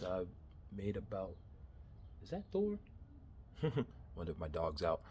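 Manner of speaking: ordinary talk, nothing marked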